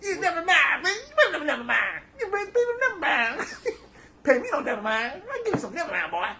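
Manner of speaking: high, loud cartoonish mock [name]; a hammed-up squeaky Kurt haggling. Haggling with the seller as a mock [name], high and loud. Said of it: "That's how he talks".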